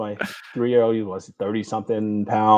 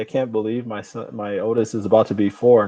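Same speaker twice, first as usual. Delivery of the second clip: ordinary talk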